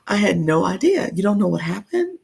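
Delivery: ordinary talk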